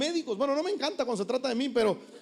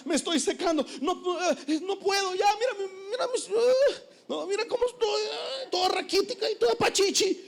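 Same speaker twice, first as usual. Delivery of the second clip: much higher, crying wilted-plant whine; hammed-up high crying; whimpering plant; 10 semitones higher than usual. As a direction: sob like a drying plant. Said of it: "como si una planta viniera y dijera".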